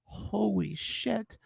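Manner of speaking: soft, slow, stunned holy shit; stunned awe. Retold or imitated. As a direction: breathe it out in disbelief. Stunned on learning who she was.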